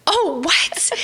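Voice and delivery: mock alarm at the call forward; startled. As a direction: caught off guard. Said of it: "And I was like".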